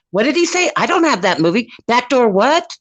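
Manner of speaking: put-on high, baffled old-lady voice; lightly voiced grandma who has misheard the title; a hint of a puzzled grandma, pitched well up; confused grandma. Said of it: "she's like".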